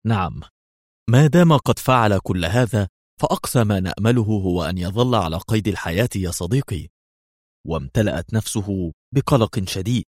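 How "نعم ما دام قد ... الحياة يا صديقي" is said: ordinary delivery, nothing marked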